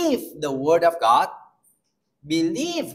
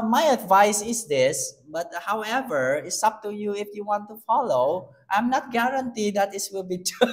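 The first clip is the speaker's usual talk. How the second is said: mock-unsure advisor, laughing; hesitant counselor; 7 semitones higher, 4 dB louder than usual. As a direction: hedge every word while laughing. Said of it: "that is definition".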